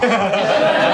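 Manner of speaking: burst of laughter. Real, not acted. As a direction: break into a big laugh. Laughing while telling hustle story.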